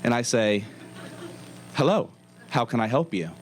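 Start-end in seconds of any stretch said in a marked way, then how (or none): none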